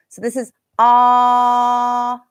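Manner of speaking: slow, held open "ah"; held ah vowel. Shown as a demonstration. Teacher demonstrating the ah vowel to students, held. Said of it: "it's the ah sound".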